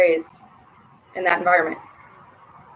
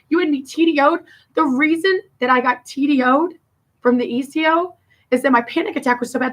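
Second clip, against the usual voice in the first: rising anger; 5 semitones higher than usual. Real, not acted. Venting at her sister Ruth, high and bitter. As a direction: voice pitched up high, tearful and bitter.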